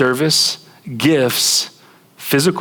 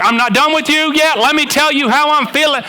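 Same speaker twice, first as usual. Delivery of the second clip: fighter chasing spouse; 13 semitones higher than usual. Retold or imitated. Fighter chasing the spouse who walks away, in a high mock squeal. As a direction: squeal it after them, not letting go. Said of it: "you're like".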